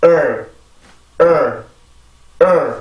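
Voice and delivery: "을" as an English "er", tongue held in R; isolated falling "을" sounded like "er"; R-colored vowel demo. Shown as a demonstration. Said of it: "이 발음은 이렇게 발음을 해요". ("을"을 영어 R 붙은 '얼'처럼 발음)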